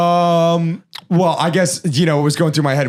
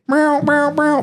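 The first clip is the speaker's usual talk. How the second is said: high wailing siren by mouth; mock alarm; 12 semitones higher, slower than usual. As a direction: sound a high-pitched complaint alert. Mock alarm before complaint.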